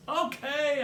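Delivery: high-pitched call back. A narrator's reading. Brother answering his sister's banging, calling out. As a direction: call back through the door. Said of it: "I call".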